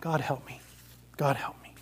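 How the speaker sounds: very soft, whispered whimper. Acted. Ghost whimpering in prayer as the angel strikes. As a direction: plead in a broken whisper. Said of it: "but ended whimpering".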